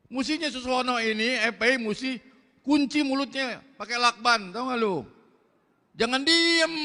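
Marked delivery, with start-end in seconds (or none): 6.0-6.8 forceful call. Real, not acted. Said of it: "Nggak bisa pelan gua".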